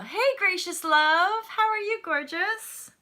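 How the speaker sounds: gushing greeting. Real, not acted. Greeting a live commenter.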